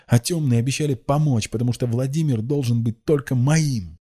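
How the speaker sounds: high, loud lovesick outburst; crazed cry. A narrator's reading. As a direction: cry out madly in love. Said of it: "вскричала безумно Рассана".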